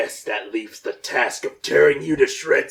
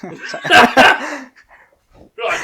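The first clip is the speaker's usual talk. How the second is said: high, loud line through laughter; slow laughing parody shout; laughing mock Vegeta; 10 semitones higher, 8 dB louder than usual. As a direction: imitate it while cracking up.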